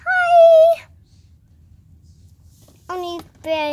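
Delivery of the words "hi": excited doll hello. Pretend. Doll greets at VIP door.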